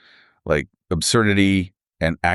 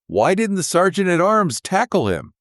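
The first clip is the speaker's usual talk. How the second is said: lightly raised, incredulous quote; a hint of mock disbelief, pitched up; mock outrage; 12 semitones higher than usual. Hosts quoting the panel's disbelief, mildly high. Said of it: "they were all like".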